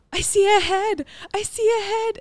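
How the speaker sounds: high, loud excited call; high excited "I see a head"; excited announcing. Retold or imitated. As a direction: announce the head with thrilled surprise. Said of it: "Christina's like".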